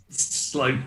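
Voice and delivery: usual speaking voice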